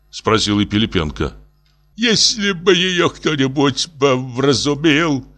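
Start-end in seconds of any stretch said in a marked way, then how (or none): 1.9-5.3 slow, high, drawn-out groan; moaning. A narrator's reading. Professor longs for someone to reason with her, moaning. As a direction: moan it wistfully. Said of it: "простонал профессор".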